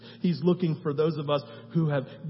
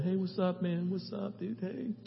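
higher, softer breezy offhand greeting; casual greeting; 5 semitones higher, 6 dB quieter than usual. Retold or imitated. Congregants casually greeting at communion, breezy and offhand. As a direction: toss it off like greeting a buddy. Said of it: "you're not just walking up here and going".

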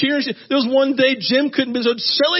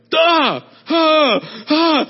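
shocked gasps; 5 semitones higher, 4 dB louder, much slower than usual. Retold or imitated. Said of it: "I'm like".

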